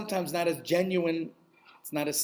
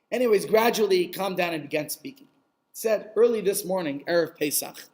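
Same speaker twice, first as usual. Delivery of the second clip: usual speaking voice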